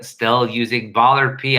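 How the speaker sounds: usual speaking voice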